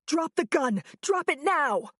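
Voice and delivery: high commanding yell. A narrator's reading. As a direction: bark the order at the gunman. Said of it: "I yelled".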